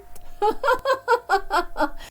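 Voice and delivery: high mocking cackle at her sister; mocking laugh. Nada mocking her sister's gift.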